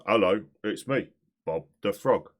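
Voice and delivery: low-key cartoon frog greeting; understated frog character hello; Bob the Frog. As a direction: give the frog voice at conversational volume. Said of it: "Bob's your fave voice".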